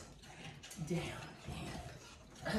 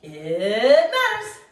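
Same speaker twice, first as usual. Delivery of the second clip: loud swooping squeal, drawn out; playful hype; 7 dB louder, much slower than usual. Real, not acted. Trainer cheering on the class mid-exercise, playful excitement. Hyping up the workout's benefit.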